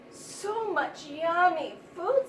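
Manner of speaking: longingly, like a hungry little mouse; mouse friend. A narrator's reading. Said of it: "said the little mouse's friend".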